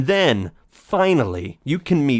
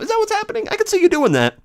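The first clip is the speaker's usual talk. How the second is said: high mocking; much faster than usual. Mocking Alphys.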